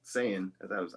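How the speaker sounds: halting, with an "uh" and a long pause; hesitant, stop-and-start delivery; realistic hesitation. Shown as a demonstration. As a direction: stall mid-sentence as if searching for words. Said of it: "gotta sound realistic about hesitating".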